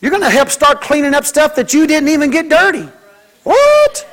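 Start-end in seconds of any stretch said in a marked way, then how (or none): 3.4-4.0 a sudden very high shocked yelp; mock outrage. Child told to do chores, very high indignant squeal.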